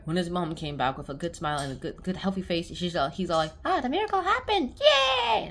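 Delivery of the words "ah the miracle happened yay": high joyful cheer; overjoyed. Retold or imitated. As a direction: cheer with delight. Boy overjoyed.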